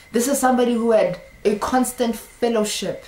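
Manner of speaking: normal talking voice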